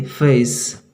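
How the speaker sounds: फेस with a gliding "ay" vowel; British long A. Shown as a demonstration. Teacher spells then models the word, gliding British vowel. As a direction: stretch the ए in फेस into ay. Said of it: "तो ऐसा जब उच्चारण होता है ए".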